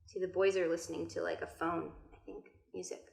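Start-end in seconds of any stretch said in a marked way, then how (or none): none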